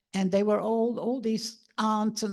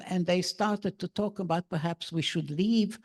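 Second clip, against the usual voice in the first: ordinary delivery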